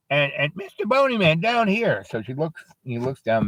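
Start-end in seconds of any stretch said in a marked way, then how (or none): 0.5-2.0 put-on high-pitched child calling for attention; little girl. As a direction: pipe up from down below. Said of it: "this little girl comes up to him".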